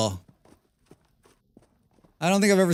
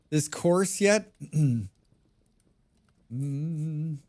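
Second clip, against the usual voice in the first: ordinary delivery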